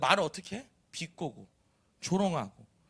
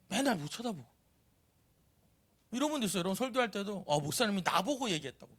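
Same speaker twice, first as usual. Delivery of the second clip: ordinary talk, nothing marked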